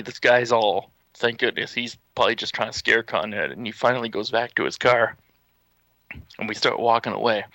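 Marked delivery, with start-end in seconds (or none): none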